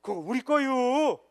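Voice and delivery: put-on slow, easygoing Chungcheong accent; slow Chungcheong accent, laid-back claim; Chungcheong dialect. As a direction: drag it out like a Chungcheong local. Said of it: "충청도 가니까 또 반대로 얘기하던데요".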